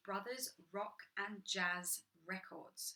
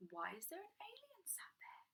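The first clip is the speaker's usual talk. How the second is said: hushed, unvoiced whisper of pretend puzzlement; mock whisper; 10 semitones higher, 9 dB quieter than usual. Reading to kids, whispering a puzzled question to them. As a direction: lean in and whisper it like a shared secret.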